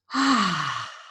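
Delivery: audible open sigh out; long exhaling sigh. Shown as a demonstration. Teacher demonstrating the relaxing breath, long audible sigh. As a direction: let the breath pour out in a sigh. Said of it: "let's just kinda sigh".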